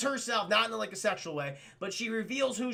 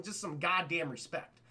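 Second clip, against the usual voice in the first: usual speaking voice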